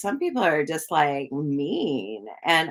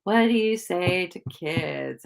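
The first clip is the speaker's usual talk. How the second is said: normal talking voice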